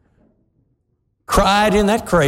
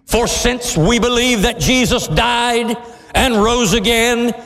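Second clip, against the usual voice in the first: scripture reading; 6 semitones higher than usual. Acted. Preacher reads Paul's letter to congregation, fervent and raised. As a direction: read it like a proclamation.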